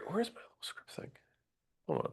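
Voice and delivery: whispered to himself; real muttered whisper under the breath. Real, not acted. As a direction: breathe it to yourself while searching. Hunting for notes mid-lecture.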